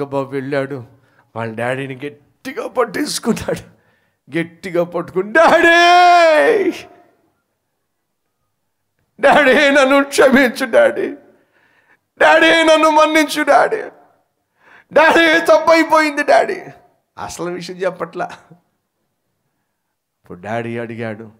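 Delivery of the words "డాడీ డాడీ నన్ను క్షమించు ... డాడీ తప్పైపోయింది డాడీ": desperate pleading cry. Acted. Boy clings to father begging forgiveness.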